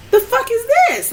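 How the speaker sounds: high, disbelieving exclamation; what on earth is this; incredulous. Retold or imitated. Her own incredulous thought.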